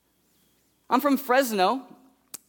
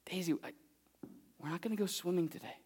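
soft letdown; 3 semitones lower, 15 dB quieter, faster than usual. Retold or imitated. Breaking bad news softly.

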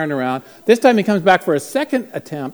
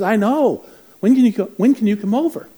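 higher, louder imitation of an urgent mom; mock-urgent plea for a house call; urgent pleading; 7 semitones higher, 4 dB louder than usual. Desperate mom pleads for a visit.

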